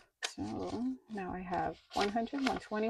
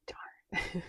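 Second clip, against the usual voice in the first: whispered darn; 4 dB quieter than usual. Retold or imitated. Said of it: "And I'm like".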